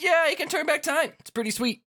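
high-pitched, gushing enthusiasm; excited boast. Acted. Fret brags about friend's time trick, excited.

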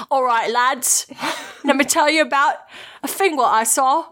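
hammed-up laddish English accent; mock English accent. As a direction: play an English bloke telling the lads a tale. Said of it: "I'm really sorry to all the English listeners".

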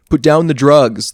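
loud, slow stern order; stern command. A narrator's reading. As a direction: bark it down from above. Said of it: "not in a kind or patient tone".

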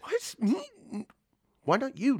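high startled disbelief; shocked squeak. Acted. Picked to roll the dice.

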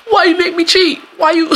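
high, loud whiny accusation; blame-flipping cheater. Retold or imitated. Cheater flipping it on the partner, high whiny accusation.

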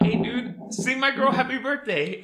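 retold high-pitched plea to a buddy; acting out an eager ask; eager request. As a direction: pitch it up, asking an excited favor. Retold request called to King.

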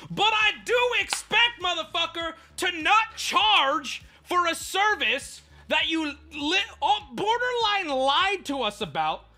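indignant rant. Real, not acted. Ranting to viewers about being charged, real high loud indignation. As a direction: let it boil over, stretching the words.

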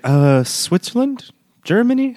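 high, slow puzzled guesses; puzzled guessing. Retold or imitated. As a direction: mimic her unsure guesses, drawn out. Retelling woman's puzzled guesses.

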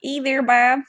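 attempted Wisconsin accent. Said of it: "I wanna sound like I'm from there".

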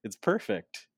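high, amazed praise. Retold or imitated. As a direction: marvel at the likeness. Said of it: "It's like".

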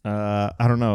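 ordinary talk

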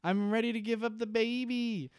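high, light dazed voice; mock Claire. Host voices Claire waking in the woods, mock dazed. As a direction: say it like a spaced-out girl, hammed-up. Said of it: "she's just like".

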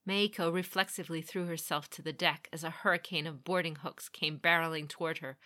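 ordinary delivery, nothing marked

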